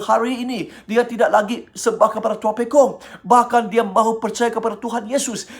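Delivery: usual speaking voice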